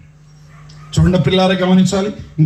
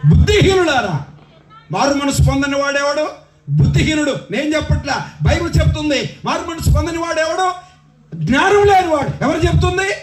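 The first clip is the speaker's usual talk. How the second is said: rhythmic, building sermon cry; full-force preaching; 12 semitones higher than usual. Real, not acted. Preacher says the Bible calls the unrepentant foolish, full-force preaching. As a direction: pile up the charges phrase by phrase.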